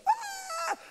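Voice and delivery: sudden high yelp; high startled cry. Retold or imitated. Preacher imitating a healed lady crying out. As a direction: let out a sharp high "ah". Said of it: "She went".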